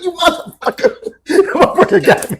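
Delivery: high, breaking laughter through the words; wheezing laugh at being burned; laughing curse. Real, not acted. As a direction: laugh it out, half-admiring. Laughing at the burn.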